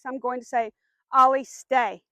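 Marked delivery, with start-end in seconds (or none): 1.1-2.0 loud, firm command; firm command called out so the dog hears it. Shown as a demonstration. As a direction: order the dog to hold still, like a stop sign. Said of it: "in a pretty firm voice".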